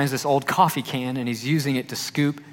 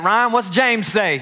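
Southern quiz; 8 semitones higher, 5 dB louder, slower than usual. Retold or imitated. Larry quizzes him, Southern voice.

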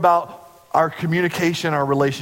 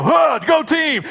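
high, loud mock team yell; imitating the team breaking the huddle; team cheer shout; 13 semitones higher, 4 dB louder, slower than usual. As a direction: belt it like a locker-room cheer. Team cheer after prayer.

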